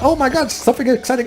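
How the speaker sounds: high-pitched imitation of an excited crowd; mock excitement. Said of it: "everybody's all like".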